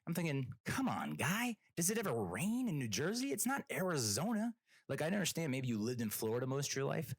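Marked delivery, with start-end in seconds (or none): 0.7-4.5 high, exasperated disbelief; mock-outraged rhetorical questioning; incredulous. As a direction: pitch it up, baffled by a dumb question. Incredulous inner reply to driver.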